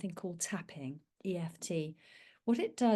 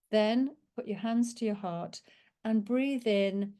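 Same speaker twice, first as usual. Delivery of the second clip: usual speaking voice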